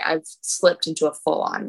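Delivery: ordinary talk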